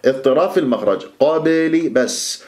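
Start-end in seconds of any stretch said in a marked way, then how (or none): 1.2-1.9 قبلي with the b struck and released at once; qalqalah bounce. Shown as a demonstration. Teacher shows students qalqalah, bouncing b in قبلي. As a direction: hit the b of قبلي and escape. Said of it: "في القلقلة تضرب المخرج وتهرب".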